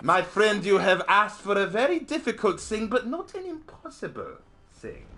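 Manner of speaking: hammed-up German accent, ceremonious and deliberate. Shown as a demonstration. Streamer answers a request to do a German, hammed-up German accent. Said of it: "you want me to do a German accent".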